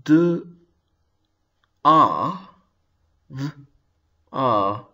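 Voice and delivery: "d, a, v, a, r" sound by sound with long pauses. Shown as a demonstration. Teacher showing learners how we sound out the word, sounding out. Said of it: "we sounded out d".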